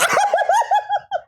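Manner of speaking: high shrieking laugh; real helpless laughter. Real, not acted. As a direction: crack up at the screen. Laughs at the AI story.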